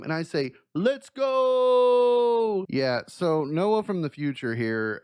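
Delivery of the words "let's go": loud, high, drawn-out "Let's go"; pumped-up meme shout; hyped shout. Acted. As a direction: stretch the "go" out like a hype call. Hyped reply to wife.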